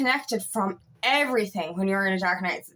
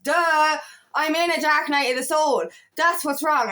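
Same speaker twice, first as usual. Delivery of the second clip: higher, emphatic "duh" of sudden realization; retold lightbulb moment, pitched up; aha moment; 4 semitones higher than usual. Speaker tells herself what's wrong, emphatic aha realization.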